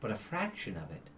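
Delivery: measured, grave and patient; celestial angel. Acted. GM voices the celestial.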